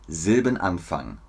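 ordinary talk, nothing marked

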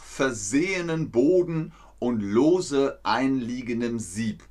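measured, word-by-word delivery; slow, careful reading. Shown as a demonstration. Teacher working through old complicated German, slow careful reading. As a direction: spell out the old wording slowly. Repeats patent title slowly.

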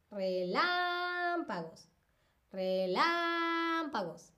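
relámpagos slow, LÁM held high and long; high sustained LÁM in relámpagos, then drop off; stress on LÁM. Shown as a demonstration. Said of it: "Relámpagos es una palabra esdrújula".

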